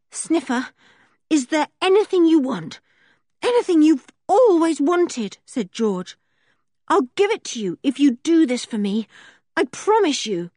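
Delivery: hurried and desperate, asking a favour; breathless urgency. Acted. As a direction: George urgently pleads with Sniffer. George bargaining with Sniffer.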